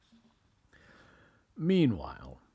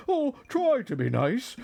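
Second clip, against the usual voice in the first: high, louder frightened plea; whimpering; 12 semitones higher, 5 dB louder than usual. A narrator's reading. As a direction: beg like a terrified lion. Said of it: "he whimpered".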